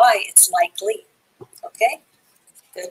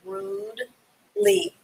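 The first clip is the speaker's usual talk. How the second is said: slow model word; 12 semitones higher, 6 dB quieter, much slower than usual. Shown as a demonstration. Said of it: "The E is silent when you pronounce it".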